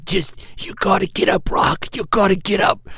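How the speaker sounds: put-on old boxing coach urging; Mickey from Rocky. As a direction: push a fighter to get up. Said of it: "sounds like Mickey from Rocky".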